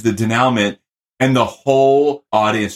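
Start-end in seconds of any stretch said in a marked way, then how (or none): 0.0-0.8 "denouement" as de-NOW-ment; innocent mispronunciation. Retold or imitated. Retelling a blunder at a writing meeting, "denouement" mispronounced de-NOW-ment. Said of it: "my teacher always said it was".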